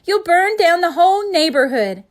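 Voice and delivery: loud, high frantic cry; mother crying out at her husband; alarmed cry. A narrator's reading. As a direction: yell in alarm at him. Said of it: "cried his mom".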